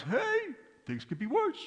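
high, bright "Hey" then a knowing lilt; cheery rabbi. Retold or imitated. Rabbi brightly reassures the complaining man, high and sing-song. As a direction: toss off the comfort like a punchline. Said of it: "And the rabbi goes".

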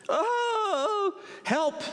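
high, drawn-out wail, then help; cry for help. A narrator's reading. Mouse falls into a hole, crying for help.

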